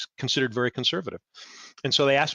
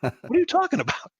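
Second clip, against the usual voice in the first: high, incredulous mock protest; baffled retort; faster than usual. Answering the conservative friend, high and incredulous. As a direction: throw the question up in disbelief.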